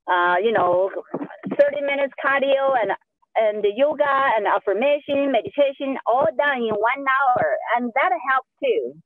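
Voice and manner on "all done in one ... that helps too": normal talking voice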